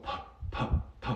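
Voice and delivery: "p" as a bare puff of air; aspirated p. Shown as a demonstration. Teacher shows English stop consonants, breathy puffed "p". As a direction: pop "p" with no voice, just breath.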